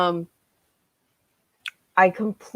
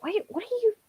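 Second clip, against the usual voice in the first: high, soft puzzled question; confused; 10 dB quieter, faster than usual. Retold or imitated. Reader reacting to the strange dancer, high puzzled. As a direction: trail off up high in quiet confusion. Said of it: "it was like".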